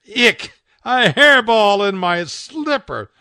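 high, loud, slow disgust. Acted. Owner finding the hairball in a slipper, loud disgust. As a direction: recoil at the hairball.

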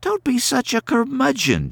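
put-on a brisk young woman scolding gently; Carrie's voice. A narrator's reading.

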